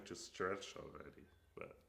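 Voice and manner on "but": ordinary talk, nothing marked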